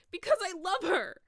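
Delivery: very high, loud shriek. A narrator's reading. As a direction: shriek it out in desperation. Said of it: "Draco shrieked".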